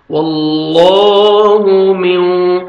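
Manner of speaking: slow, melodic tajweed chanting; Quran recitation. Shown as a demonstration. Teacher reciting the verse for students to repeat.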